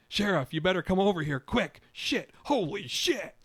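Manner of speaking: excited shout. A narrator's reading. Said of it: "an excited shout".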